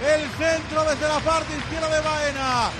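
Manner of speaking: excited radio commentary; live play-by-play. Real, not acted. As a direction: narrate the replay with energy. (narración radiofónica del gol)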